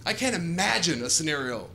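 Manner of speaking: ordinary talk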